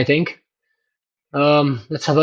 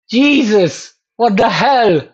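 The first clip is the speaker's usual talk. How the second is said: surprised; 6 semitones higher, slower than usual. Real, not acted. Shocked by the graph.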